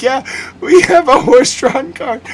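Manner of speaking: deadpan offer drowned in giggles; laughing voice. Retold or imitated. Staff offers a cart.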